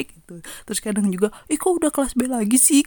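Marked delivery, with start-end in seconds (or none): 1.5-2.9 tag. Retold or imitated. Rival students grumbling, retold.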